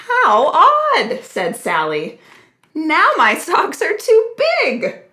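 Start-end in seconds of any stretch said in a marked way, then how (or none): 0.1-1.2 puzzled, higher opening word; surprised. A narrator's reading. Sally's startled reaction in the story. 2.7-5.0 high-pitched, dismayed exclamation. A narrator's reading. Sally dismayed at oversized socks.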